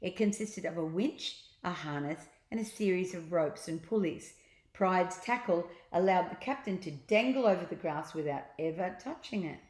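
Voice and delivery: ordinary delivery, nothing marked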